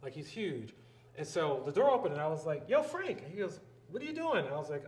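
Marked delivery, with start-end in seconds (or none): 2.7-3.2 high, loud hail to a friend; calling out. Retold or imitated. Calling to man past elevator doors. 3.9-4.4 high, louder surprised question; surprised greeting. Retold or imitated. Retold surprise at chance meeting.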